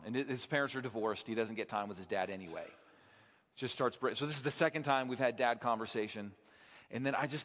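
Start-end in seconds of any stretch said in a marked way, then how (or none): none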